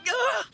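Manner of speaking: high yelp of terror; frightened scream. Real, not acted. [name] screams on seeing Paimon, frightened high yelp. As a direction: shriek as if seeing a ghost.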